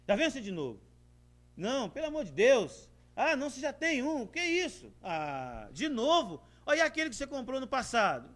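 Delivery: high, loud exasperated complaint; mock annoyed husband griping at his wife over a purchase; irritated husband. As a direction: scold her like a fed-up husband. Husband voiced scolding wife.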